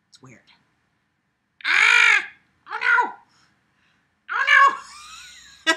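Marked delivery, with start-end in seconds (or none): 1.7-2.2 high, loud mock-pain scream; short squealed yelp at a pet's bite; playful shriek. Playful cry as pet bites. 2.7-5.7 very high, loud "oh no" shriek; loud mock-alarm squeal. Playful cries while pet bites.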